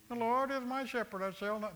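high whining recital, trailing off; mock the complainer's whine; whiny mock quote. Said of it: "kind of in a whiny tone".

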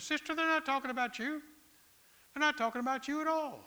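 reassuring a worried church lady. Retold or imitated. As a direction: comfort her firmly.

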